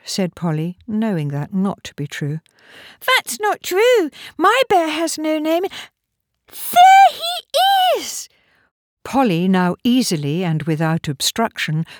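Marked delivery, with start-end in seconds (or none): 3.1-8.3 put-on very high, slow child voice; little girl protesting, then delighted. A narrator's reading. Young Polly defends and spots her bear, childlike. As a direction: protest in a high child's voice, building to a loud drawn-out cry.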